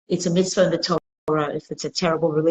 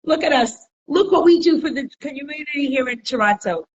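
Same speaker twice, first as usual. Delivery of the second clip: high, bright-eyed boastful chicken voice, up a lot in pitch and louder; proud chicken; 6 semitones higher, 3 dB louder than usual. Retold or imitated. Chicken boasting to the cow, high and smug. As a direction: preen like a little chicken showing off. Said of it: "and he goes".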